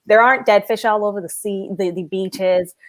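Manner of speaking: ordinary talk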